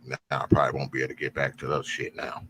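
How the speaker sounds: quick whispered aside. Real, not acted. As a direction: mutter it under breath. Muttered aside.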